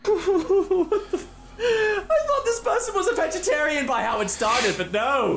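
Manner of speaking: laughing in disbelief; high giggling through the words. Real, not acted.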